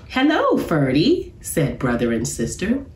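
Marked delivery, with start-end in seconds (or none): 0.1-1.3 put-on high, cheerful kids' greeting; two cubs calling hello; much higher children's hello; cub voices. A narrator's reading.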